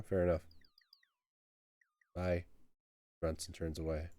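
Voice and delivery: ordinary talk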